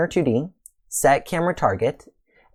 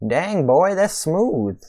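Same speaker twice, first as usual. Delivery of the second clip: excited; 7 semitones higher, slower than usual. Real, not acted. Delighted at smooth camera result.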